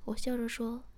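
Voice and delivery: plain reading voice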